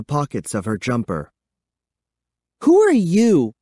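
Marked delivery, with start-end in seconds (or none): 2.6-3.5 high, loud challenge; demanding. A narrator's reading. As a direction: demand it outright, pitched up and forceful. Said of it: "Kara demanded".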